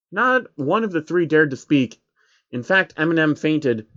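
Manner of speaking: usual speaking voice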